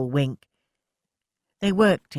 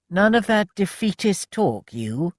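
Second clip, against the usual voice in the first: teasing; 6 semitones higher, 4 dB louder than usual. A narrator's reading. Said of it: "Samuel teased".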